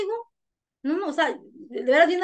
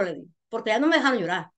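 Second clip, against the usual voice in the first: normal talking voice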